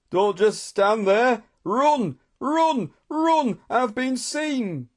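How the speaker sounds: high, drawn-out cries; panicked urging. A narrator's reading. Tando fleeing after being seen, panicked. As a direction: call out in rising alarm, word by word.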